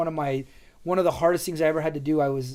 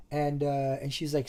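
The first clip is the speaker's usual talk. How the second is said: ordinary delivery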